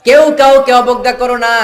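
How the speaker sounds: high, loud sermon warning; fiery preaching cry; thundering preacher. Acted. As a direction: boom the warning out. Preaching at full force.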